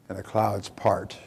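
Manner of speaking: ordinary delivery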